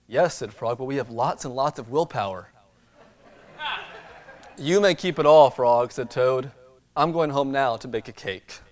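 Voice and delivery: lively storybook reading; reading to children. Acted. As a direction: read it like a bedtime picture book. Reads storybook to congregation.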